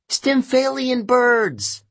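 high, drawn-out yell; slow, high-pitched cry of alarm; Annabeth. A narrator's reading. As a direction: yell the warning, stretching it out. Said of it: "Annabeth yelled".

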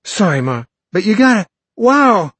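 put-on high, loud, breathless kid; excited boy voice. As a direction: gush it out in a hurry. Excited boy apologizes, rushes off.

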